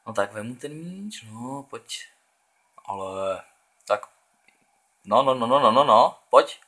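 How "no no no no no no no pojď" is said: excited urging. Real, not acted. Urging player toward goal.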